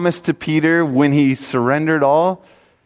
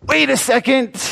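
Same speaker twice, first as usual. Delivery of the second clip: jumping higher, caught short; sudden realization; 11 semitones higher than usual. Retold or imitated. Preacher retelling his airport realization, suddenly alarmed. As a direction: stop mid-thought with alarm.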